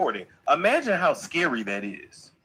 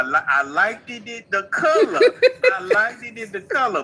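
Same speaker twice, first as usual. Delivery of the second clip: laughing; 6 semitones higher, 5 dB louder than usual. Real, not acted. Teasing while laughing.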